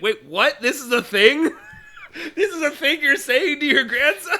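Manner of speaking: laughing, rising disbelief; baffled and amused; surprised disbelief. Retold or imitated. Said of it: "I was like".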